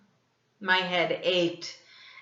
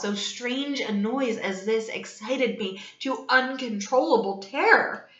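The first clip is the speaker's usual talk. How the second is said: loud, building, agitated narration; dramatic story reading; 3 semitones higher, 6 dB louder than usual. A narrator's reading. Narrator telling the class of his mounting terror. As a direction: drive the tale toward terror.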